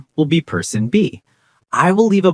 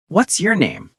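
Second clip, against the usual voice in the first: higher-pitched, friendly question falling at the end; friendly and curious; 8 semitones higher than usual. Shown as a demonstration. Teacher models asking someone's name, friendly and curious. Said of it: "Make it sound friendly and curious".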